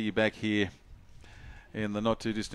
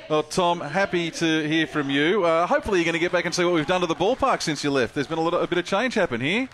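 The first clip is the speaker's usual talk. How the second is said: excited greeting; 6 semitones higher, 3 dB louder than usual. Real, not acted. Greeting a viewer watching live.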